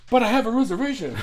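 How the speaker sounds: high, loud, fast indignant Seinfeld whine; Seinfeld character quote. Retold or imitated. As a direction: laugh through the outraged protest. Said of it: "He's like".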